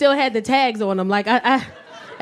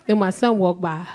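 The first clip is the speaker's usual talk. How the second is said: usual speaking voice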